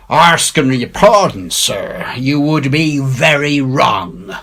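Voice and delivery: put-on high, slow rough old seaman, politely objecting; a fawning pirate warning the doctor; Silver's pirate voice. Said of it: "quoth Silver".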